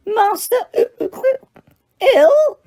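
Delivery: very high, rising into a wail; tearful Winky. A narrator's reading. Winky hears her master is ill and wails. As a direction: squeak it out in shocked distress.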